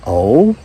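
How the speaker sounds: oh? with a light questioning rise; inquiring oh. Shown as a demonstration. Showing the viewers the yuppie-ish questioning oh. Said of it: "It's the inflection on the word".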